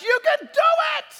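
very high, loud cheering shout; playful crowd shout from the far side. Retold or imitated. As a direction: yell it from across the falls. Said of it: "And Canada shouts".

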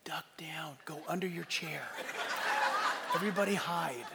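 hushed mock-secret instructions; conspiratorial whisper. Pastor secretly directing the congregation around a sleeper, conspiratorial whisper. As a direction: whisper it, as if not to wake someone.

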